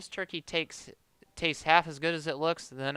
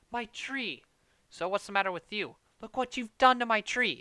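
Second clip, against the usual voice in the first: high, loud outburst; outraged cry; 6 semitones higher, 5 dB louder than usual. Acted. Clark yelling at the uncle over the burned tree, outraged. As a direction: wail over the ruined tree.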